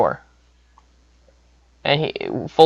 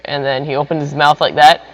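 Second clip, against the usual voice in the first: plain reading voice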